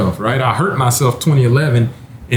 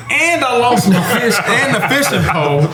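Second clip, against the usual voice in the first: laughing; 8 semitones higher than usual. Real, not acted. Laughing at his lost fishing pole.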